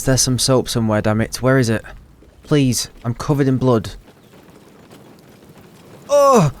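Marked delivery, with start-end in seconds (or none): 6.1-6.5 startled oh. Real, not acted. Ghost appears suddenly.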